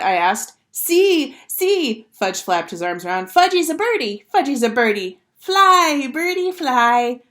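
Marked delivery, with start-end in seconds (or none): 0.7-2.1 put-on loud, very high little-kid call; excited toddler. A narrator's reading. Little brother showing off to Peter, excited toddler. Toddler calling from jungle gym. 3.3-7.2 put-on high, loud little-kid singsong; excited toddler. A narrator's reading. Fudge flapping his arms to fly, excited toddler.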